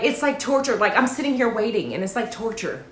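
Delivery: ordinary talk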